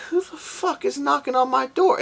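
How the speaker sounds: high sleepy who the fuck; groggy outrage. Retold or imitated. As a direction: squeal it, roused from sleep. Woken by knock, retold.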